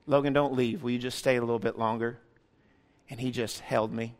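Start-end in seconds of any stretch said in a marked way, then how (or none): 0.1-2.2 soft plea. Retold or imitated. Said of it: "He says".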